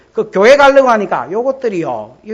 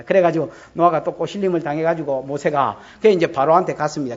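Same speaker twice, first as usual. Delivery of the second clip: ordinary talk, nothing marked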